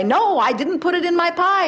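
hammed-up protesting friend; mock defensive friend. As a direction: squeak out the stubborn denial. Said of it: "the more they'll say".

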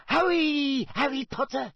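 squeaky, high-pitched house-elf urging; Dobby. A narrator's reading. Elf waking Harry in the library, squeaking urgently. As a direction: squeak it urgently, far above normal pitch. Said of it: "squeaked Dobby".

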